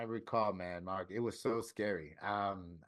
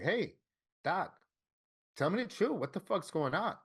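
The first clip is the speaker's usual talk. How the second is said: higher-pitched, pleading demand; angry questioning; 7 semitones higher than usual. Retold or imitated. Patient confronting his doctor about what's happening, angry. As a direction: press the doctor for a straight answer, upset. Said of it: "I'm getting angry".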